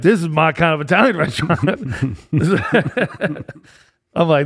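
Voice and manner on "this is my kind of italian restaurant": louder, higher, amused retold reaction; playfully delighted re-enactment; mock delight. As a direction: exclaim it with amused relish, laughter following. Acted reaction to the surprise venue.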